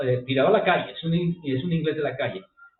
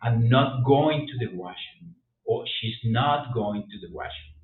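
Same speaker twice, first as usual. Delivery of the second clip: slow model sentence; 4 semitones lower, slower than usual. Shown as a demonstration. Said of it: "abrimos más la boca".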